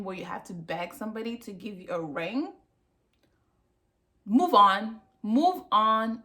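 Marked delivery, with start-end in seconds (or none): 4.2-6.2 loud, firm command repeated twice; forceful repeated advice to the viewer; emphatic urging. Real, not acted. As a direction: tell her straight, move on. Urging viewer to leave relationship.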